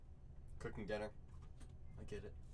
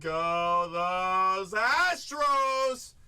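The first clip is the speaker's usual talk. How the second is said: slow, loud, stretched announcer call; drawn-out hype call; 5 dB louder, much slower than usual. Real, not acted. Breaker hyping chat as a team goes off the board, drawn-out call. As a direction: draw out the team name like a ring announcer.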